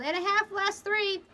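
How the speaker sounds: high-pitched leadsman's call; yell. A narrator's reading. Leadsman reporting dangerous depth, yelling. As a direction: yell the shallow sounding. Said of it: "Watson yelled".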